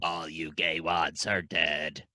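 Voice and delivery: deep, drawn-out mock alien threat; alien Splurn. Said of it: "his name's Splurn".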